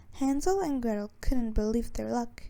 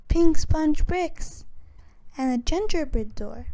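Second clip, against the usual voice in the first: excited; 5 semitones higher, 6 dB louder than usual. A narrator's reading. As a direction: gasp out the wonder. Gretel marvels at candy house.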